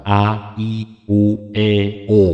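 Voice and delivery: clearly articulated "A, I, U, E, O"; vowel drill. Shown as a demonstration. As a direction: sound out each vowel "A, I, U, E, O" crisply. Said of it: "artikulasi yang jelas". (latihan vokal "A, I, U, E, O" dengan artikulasi jelas)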